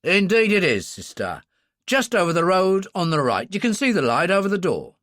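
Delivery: loud, friendly London bobby; a cheerful constable giving directions; hearty policeman voice. Acted.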